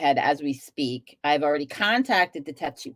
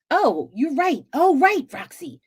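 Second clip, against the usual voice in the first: sudden realization; 9 semitones higher, 4 dB louder than usual. Real, not acted. Reacting to a viewer's comment.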